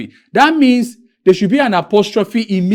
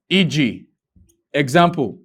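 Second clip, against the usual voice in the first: ordinary delivery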